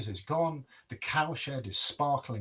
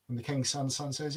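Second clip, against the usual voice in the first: plain reading voice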